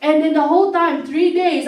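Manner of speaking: normal talking voice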